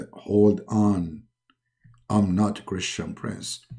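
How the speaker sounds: low, soft, unhurried deity; calm low godly voice; Allah. Acted. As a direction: correct the caller quietly in a deep voice. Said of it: "and then Allah, he call him".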